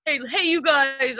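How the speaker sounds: lightly voiced buddy calling out; little voice imitation. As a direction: draw out the hey like a buddy calling. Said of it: "can you do it".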